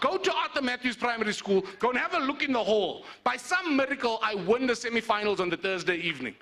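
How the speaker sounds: ordinary delivery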